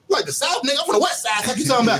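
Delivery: hammed-up indignant retort; mock indignation. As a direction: act out incredulous protest, amused. Retold indignant West Side man, laughing.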